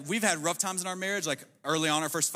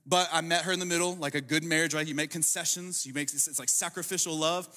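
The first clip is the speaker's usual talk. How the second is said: ordinary delivery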